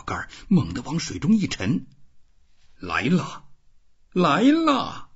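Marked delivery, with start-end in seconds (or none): 2.8-5.1 rising sharply, louder on the second; eager call as the line dips; excited cry. A narrator's reading. Old fisherman seeing the rod dip, excited call. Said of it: "他说着收起双桨".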